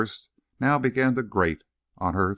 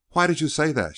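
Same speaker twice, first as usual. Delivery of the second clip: higher, rising irritation; growing angry; 6 semitones higher than usual. A narrator's reading. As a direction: challenge him, annoyed. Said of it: "growing angry".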